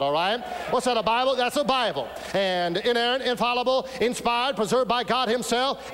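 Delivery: a preacher booming to his congregation; fiery preaching. Acted. Preaches at full force.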